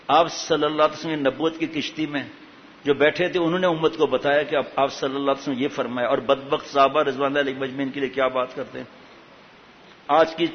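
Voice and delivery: usual speaking voice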